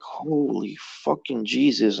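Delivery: slow, hushed amazement; retold under-the-breath astonishment; awed shock. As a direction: stretch it out softly, stunned. Sees dogs stand upright.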